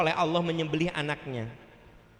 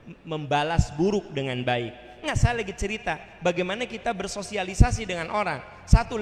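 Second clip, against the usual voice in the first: usual speaking voice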